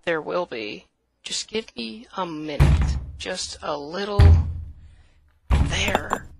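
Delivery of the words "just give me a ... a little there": loud grunting effort while forcing; straining effort. Acted. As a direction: push hard while speaking. Straining to break the exit.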